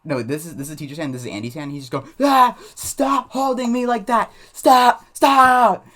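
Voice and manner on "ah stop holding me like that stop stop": high, loud tantrum screams; mock screaming. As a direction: scream like a kid struggling to break free. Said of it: "he's just screaming".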